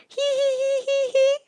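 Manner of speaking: very high, light giggling hee-hee; kingfisher giggle. A narrator's reading. Kingfisher giggling at the paddlers, very high. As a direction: giggle playfully like a little bird. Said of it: "giggled a kingfisher".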